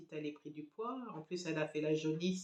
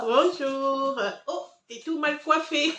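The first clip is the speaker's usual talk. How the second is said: much higher, louder doting voice; doting mother; 9 semitones higher, 10 dB louder, slower than usual. Real, not acted. Mother fusses over her daughter's messy hair, doting high voice.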